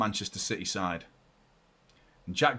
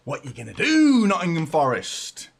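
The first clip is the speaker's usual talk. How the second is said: taunting; 6 semitones higher, 8 dB louder than usual. Real, not acted. Taunting the opponent.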